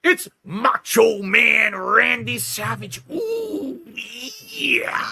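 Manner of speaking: slow, high, hammed-up wrestler voice; Macho Man impression. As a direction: drawl it like a bad wrestler impression. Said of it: "That's not how he talks".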